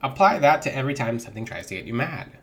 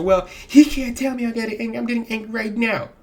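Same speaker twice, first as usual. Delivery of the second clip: higher, louder huffy viewer voice; mock annoyed viewer; 9 semitones higher, 4 dB louder than usual. Viewer mocked as clicking off the video, mildly indignant. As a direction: play the offended viewer, a bit put on. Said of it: "people will click off a video".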